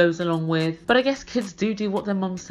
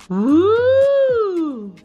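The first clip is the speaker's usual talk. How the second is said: long, loud high hoot; mimicked rising "woo", held long; high-pitched whoop; 11 semitones higher, 7 dB louder than usual. Tom jeering at Darcy's words, high-pitched whoop. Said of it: "And then Tom went".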